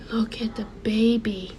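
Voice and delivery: hushed whisper of realisation; soft whisper. Retold or imitated. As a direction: breathe it out as the meaning dawns. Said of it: "I said".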